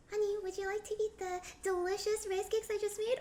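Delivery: high, sugary question to a husband; put-on honeyed wife voice; sweet coaxing wife. Rehearsed question to husband with favored answer.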